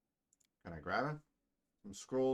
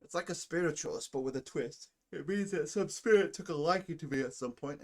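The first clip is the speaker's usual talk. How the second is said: higher and louder, boasting adventurer; Finian's voice, louder; 7 semitones higher, 8 dB louder than usual. Acted. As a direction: sell the twist with gusto. Finian explains his power.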